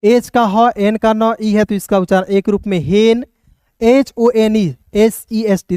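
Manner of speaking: normal talking voice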